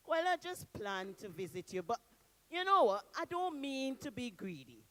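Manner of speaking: ordinary delivery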